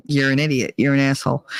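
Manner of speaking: ordinary delivery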